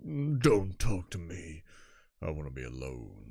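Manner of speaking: low, slow, withdrawn moan; dejected sulk. Acted. Defeated fighter wanting to be alone, dejected. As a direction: sink down slowly and push them away.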